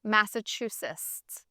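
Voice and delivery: slow, careful "Massachusetts", sounded out as a non-native speaker; careful attempt at Massachusetts. Shown as a demonstration. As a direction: slowly sound out "Massachusetts" as if trying it for the first time. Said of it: "English is my second language".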